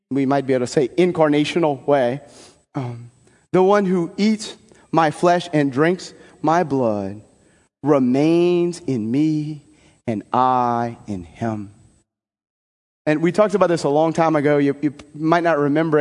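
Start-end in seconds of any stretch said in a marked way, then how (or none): 3.5-11.7 slow, measured, softening toward the end; slow scripture reading. Shown as a demonstration. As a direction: draw out "remains in me" gently.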